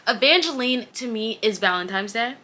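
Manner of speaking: ordinary talk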